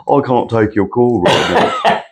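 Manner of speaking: slow, lightly put-on British accent; the phone's British accent, mildly mimicked. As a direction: imitate a British voicemail greeting. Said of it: "my phone accent is British".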